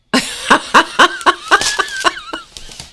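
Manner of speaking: long high-pitched staccato cackle; helpless string of high ha-has; long laugh. Real, not acted. Crafter laughing at a cat cartoon, high cackle.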